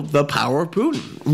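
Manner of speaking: ordinary talk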